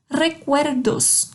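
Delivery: Recuerdos slowly, R tapped as re; slow Spanish R. Shown as a demonstration. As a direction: stretch Recuerdos and tap the R. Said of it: "O R tem um sonzinho de re". (Recuerdos bem devagar, com o R de re)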